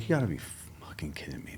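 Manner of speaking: starts up, then drops to a hushed whisper; exasperated whisper. Retold or imitated. Himself, scoffing at Jeremy's advice, whispered disbelief. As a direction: hiss of disbelief under the breath. Angry at friend's suggestion.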